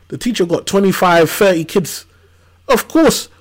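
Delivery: ordinary talk, nothing marked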